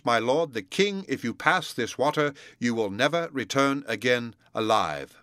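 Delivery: ordinary delivery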